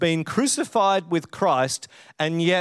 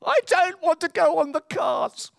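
put-on old man; 13 semitones higher than usual. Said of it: "this old man says".

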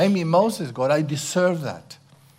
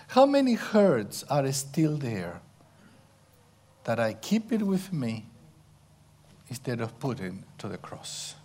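ordinary talk, nothing marked

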